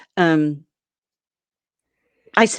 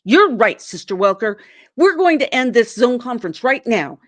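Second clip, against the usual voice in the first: higher, louder and clipped with fury; enraged reply; 4 semitones higher, 6 dB louder than usual. Retold or imitated. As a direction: cut her off in cold rage.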